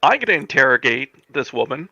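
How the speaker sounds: ordinary delivery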